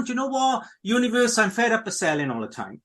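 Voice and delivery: usual speaking voice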